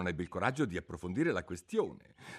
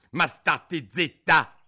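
loud, slow, higher bark; griffin's scolding; 6 semitones higher, 12 dB louder, much slower than usual. Acted. Griffin cutting Alice off, loud and slow. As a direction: clamp down on the chatter.

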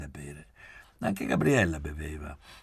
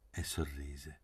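ordinary delivery

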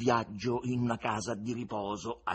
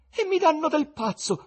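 much higher, louder outburst; indignant; 10 dB louder than usual. Acted. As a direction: fling it at the door in outrage. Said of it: "indicando sdegnato la porta".

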